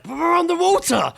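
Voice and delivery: high, loud comic cry of alarm; panicked shout. Retold or imitated. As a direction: yell it in mock panic. Panicked retold thought underwater.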